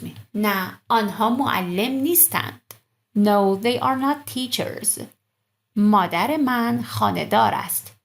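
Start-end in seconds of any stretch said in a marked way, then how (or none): 3.2-5.0 slow model. Shown as a demonstration. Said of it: "slow translation".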